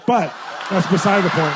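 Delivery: loud laughing aside. Real, not acted. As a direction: toss it off laughing.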